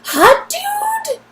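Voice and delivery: a high, teasing squeak; squeaky hot dude. Acted. Nadia ogling the stranger, high teasing squeak.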